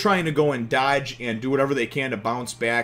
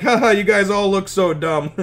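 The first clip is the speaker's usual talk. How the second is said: tearful laughing Nami; 7 semitones higher, 7 dB louder than usual. Retold or imitated. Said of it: "Nami's crying her eyes out".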